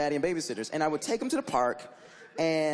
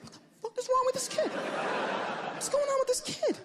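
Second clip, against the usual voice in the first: much higher, fast, incredulous with a laugh; baffled high voice. Retold or imitated. Babysitter baffled by the crying kid, high and incredulous. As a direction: squeak it out in disbelief. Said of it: "and I was like".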